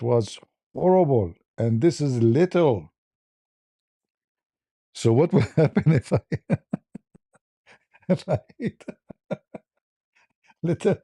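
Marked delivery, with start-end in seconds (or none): 4.9-11.0 rising, laughing, pitched up. Real, not acted. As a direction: laugh out the punchline. Laughing at his own joke.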